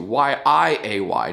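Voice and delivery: "Y I A Y" letter by letter, slow; spelled out slowly. Shown as a demonstration. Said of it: "And spell it right".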